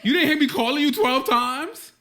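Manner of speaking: loud, high, slow annoyed complaint; exasperated. Retold or imitated. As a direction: scold him for not answering. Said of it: "she'll be like".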